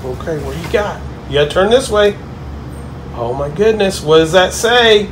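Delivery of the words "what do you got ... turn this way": ordinary talk, nothing marked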